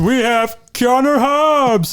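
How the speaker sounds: slow, booming host intro; announcer voice. Pretend. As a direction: announce the guest like a show host. Announcing podcast guests.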